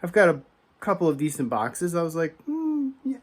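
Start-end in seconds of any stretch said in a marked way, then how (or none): 2.4-3.2 high, drawn-out thoughtful hmm; mock pondering to himself; a light put-on musing noise. Musing self-quote while deciding.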